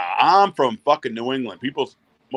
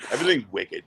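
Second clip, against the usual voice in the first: ordinary delivery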